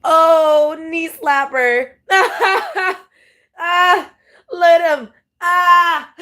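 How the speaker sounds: high, slow words dissolving into a laugh. Real, not acted. Laughing at funny video.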